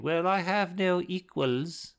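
high, drawn-out put-on voice; haughty boy genius. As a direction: play him grandly superior, stretching the words. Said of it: "I actually anticipated him saying".

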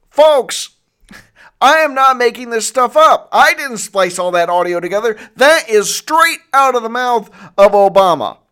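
loud and high, still riled up; worked-up outrage. Real, not acted. Telling viewers he didn't splice the audio, loud and riled. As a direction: insist loudly to the audience, fired up. Outraged after playing the clip.

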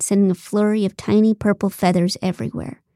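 ordinary delivery

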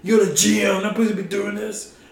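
high, fast mock-angry rant; hammed-up high angry father; furious dad. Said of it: "He's like".

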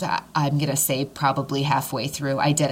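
normal talking voice

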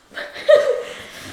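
high breathy burst of laughter. Real, not acted. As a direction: let out a loud giggle. Laughs after ending her share.

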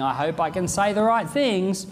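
a nervous soul winner doubting himself; anxious inner voice. Retold or imitated. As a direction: rattle off worries, speeding up. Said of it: "you're thinking".

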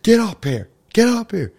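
high-pitched retold scream; high, imitated shouted command; mock screaming. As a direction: yell it like an impatient DJ ordering someone up. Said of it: "no, he's screaming at her".

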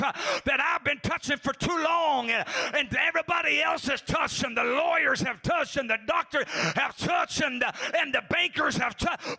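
loud, fervent revival shouting; full-force preaching. Acted. Preacher pleading for God's touch before congregation, impassioned shouting. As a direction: build from driving cadence to high, drawn-out cries.

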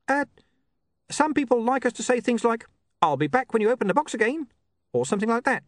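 high-pitched, eager little imp; squeaky helper imp, high and keen; chirpy imp. Acted. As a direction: rattle off the sales patter as a small imp. Voices the imp.

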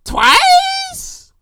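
very high, squeaky rising question; incredulous. Acted. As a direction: stretch the word up into falsetto disbelief. Incredulous at being robbed twice.

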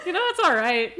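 breezy, up-tempo it's all right; upbeat. Real, not acted. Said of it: "So funny".

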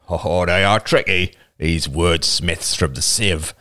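slow tribesman's character voice; Roman, scornfully amused. A narrator's reading. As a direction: draw out the grudging jibe.

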